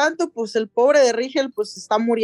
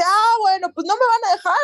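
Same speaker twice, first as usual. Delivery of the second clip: lightly raised villain voice; Eris scheming; 11 semitones higher than usual. Acted. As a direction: toss it off, pitched up.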